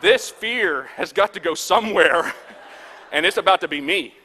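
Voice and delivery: loud, emphatic comic declaration; loud punchline. Real, not acted. Preacher tells crowd where the fear will go, loud mock alarm.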